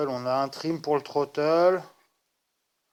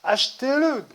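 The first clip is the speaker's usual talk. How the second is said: loud, high hype shout; mock sales cheer; 8 semitones higher, 9 dB louder than usual. Vlogger mimicking an endorsement to viewers, mock cheering shout. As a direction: cheer them on to buy, hammed up. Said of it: "je peux pas te dire".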